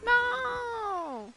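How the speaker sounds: sudden high yell of dismay; shout. Real, not acted. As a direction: cry out No in shock. Sudden loss in game.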